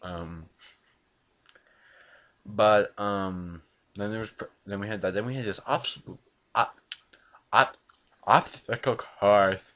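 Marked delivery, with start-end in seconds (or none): none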